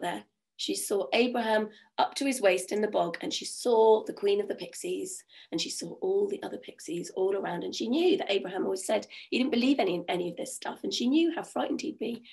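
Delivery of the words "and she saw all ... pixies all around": ordinary delivery, nothing marked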